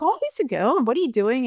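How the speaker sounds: high, eager prying; self-mocking excited parent; overexcited mum. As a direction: gush with nosy excitement, pitch way up. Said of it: "You just get really excited".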